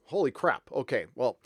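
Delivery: usual speaking voice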